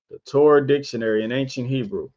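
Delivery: brisk recap of the book title; quick repeat. Shown as a demonstration. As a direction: rattle the title off a second time. Reads title twice.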